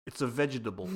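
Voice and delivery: lightly voiced New York mobster; deadpan wise-guy explanation; a hint of a mob accent; mafioso voice. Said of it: "Steve Martin laying it on thick".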